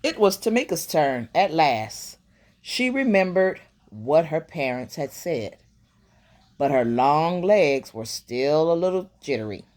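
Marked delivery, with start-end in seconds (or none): none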